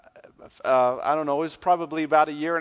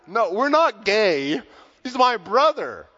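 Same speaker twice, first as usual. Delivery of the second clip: loud, slow and higher denial; loud protest; 5 semitones higher, 4 dB louder, slower than usual. Retold or imitated. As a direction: say it loud so the whole line hears. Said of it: "he says very loudly".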